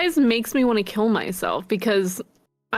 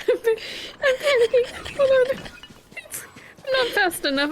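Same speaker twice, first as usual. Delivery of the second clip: high laughing panic; panicked laughing; 13 semitones higher than usual. Real, not acted. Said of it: "Fuck, I'm panicking".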